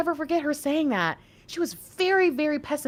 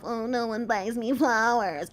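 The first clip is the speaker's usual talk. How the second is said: mock self-pity. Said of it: "that stigma of".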